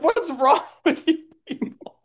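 high, laughing disbelief; incredulous. Acted. Skinny Nick appalled at the uncaring family, laughing high. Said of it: "Skinny Nick is like".

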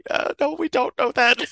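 high-pitched, puzzled reply; mock bafflement. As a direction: answer high, as baffled underlings. Baffled characters answer the villain.

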